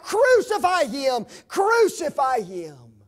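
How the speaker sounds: high, loud cry of the mob; mob shout. Acted. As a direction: yell it like the crowd demanding death. Mob crying for crucifixion.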